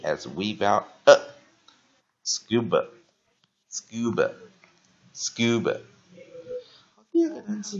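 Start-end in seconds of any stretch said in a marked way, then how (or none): 2.2-5.8 "Scuba" drawn out very slowly, stress on "Scu"; very slow model reading. Shown as a demonstration. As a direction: say "scuba" slowly so learners copy it. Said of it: "weak vowel A as". (非常慢地示范 "scuba")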